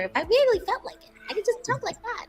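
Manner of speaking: high register demo; talking up high. Shown as a demonstration. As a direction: float the voice way up high. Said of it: "talk up here".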